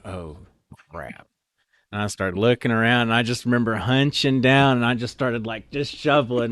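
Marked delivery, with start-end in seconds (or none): 0.1-1.3 quiet dismay. Retold or imitated. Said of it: "I just remember going".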